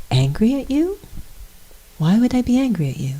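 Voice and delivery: high, innocent puzzled question; wide-eyed bafflement, pitched well up; innocent and pure. Retold or imitated. Jesus answering Pontius, innocent and pure. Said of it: "as innocent and pure".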